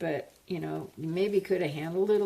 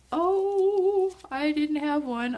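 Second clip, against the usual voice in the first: excited; 9 semitones higher, 3 dB louder, slower than usual. Real, not acted. Excited at plant.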